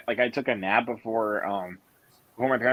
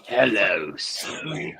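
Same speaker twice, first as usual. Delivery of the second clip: low, breathy creepy phone greeting; creepy hello; 4 semitones lower, much slower than usual. Pretend. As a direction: greet like a sinister caller. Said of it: "it sounds creepy".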